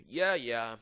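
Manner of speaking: ordinary delivery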